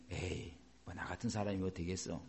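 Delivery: very low, hushed resignation; self-doubting mutter. Acted. Doubting believer talks themselves down, quiet defeated mutter. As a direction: mumble it like someone who has given up.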